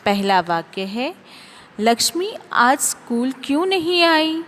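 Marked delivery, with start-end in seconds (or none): 1.8-4.4 teacher asking. Acted. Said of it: "शिक्षिका ने पूछा।".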